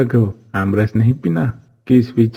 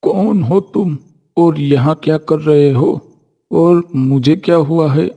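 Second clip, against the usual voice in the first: raised-pitch voice of a sadhu coming round; bewildered holy man; 4 semitones higher than usual. Acted. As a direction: ask in confusion, as if just revived. Fainted holy man comes round, confused.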